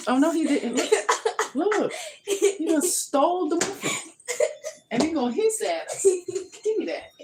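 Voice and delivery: high-pitched telling-off of a naughty cat; scolding the cat. Real, not acted. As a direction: owner scolding cat over muffin, high and loud. Scolding the cat stealing a muffin.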